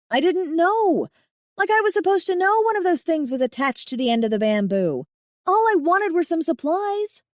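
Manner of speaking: high, loud, frantic pleading; panicked babbling. Acted. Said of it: "My babbling".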